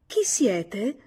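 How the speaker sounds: a woman disguising her voice behind a door; wary, disguised female voice through a door; disguised woman's voice. A narrator's reading. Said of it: "La voce ripeté".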